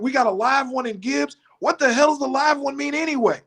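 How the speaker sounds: mock coach rant, voice high; high-pitched upset coach. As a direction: squeak it out like a big upset coach. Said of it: "his voice gets high".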